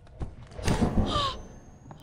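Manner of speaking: loud, sharp gasp of fright; startled gasp. Real, not acted. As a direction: gasp as if jump-scared. Startled gasp in scary game.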